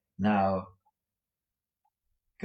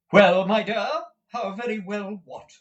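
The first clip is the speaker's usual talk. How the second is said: high, wide-eyed questioning; high motherly astonishment, loud at first; great astonishment; 11 semitones higher, 7 dB louder than usual. A narrator's reading. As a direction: ask in baffled amazement. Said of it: "in great astonishment".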